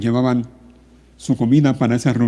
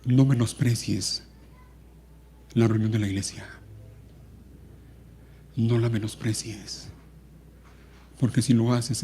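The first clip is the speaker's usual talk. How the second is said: measured and subdued pulpit voice; solemn preaching; 2 semitones lower, 4 dB quieter, slower than usual. Real, not acted. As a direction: deliver a grave, hushed warning with long pauses. Preacher gravely admonishes the congregation.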